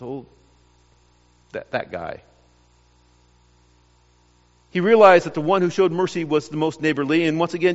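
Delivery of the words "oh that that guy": low, much softer, reluctant "that guy"; grudging mumble. Retold or imitated. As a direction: spit it out as if the name won't come. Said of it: "could not even bring himself to say the word Samaritan".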